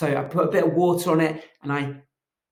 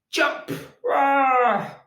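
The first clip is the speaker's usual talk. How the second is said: high yell as the frog jumps; a playful high-pitched jump shout; leaping yell; 10 semitones higher than usual. Acted. Fred jumps off bench.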